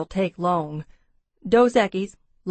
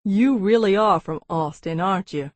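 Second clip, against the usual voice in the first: mildly wry Texan; 4 dB louder than usual. A narrator's reading. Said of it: "he asked wryly".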